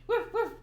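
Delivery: high little dog woofs; playful bark. Acted. Reader barking anyway despite possible listeners, playful bark. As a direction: bark twice like a small dog. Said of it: "And I barked anyway".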